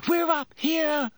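high panicked scream for help. A narrator's reading. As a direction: shriek to the people below. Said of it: "Hermione screamed suddenly".

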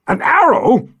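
high incredulous yell. Acted. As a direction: shout it in disbelief. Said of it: "Karg yelled".

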